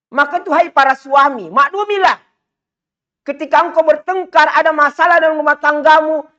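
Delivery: rising preacher's fervour; fiery preaching. Acted. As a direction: preach it out high and hard to the crowd. Preaching at full force.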